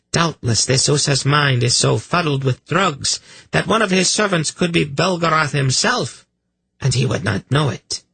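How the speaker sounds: high-pitched, quick accusing voice; scornful priestess. Acted. Priestess arguing to her master, high and scornful.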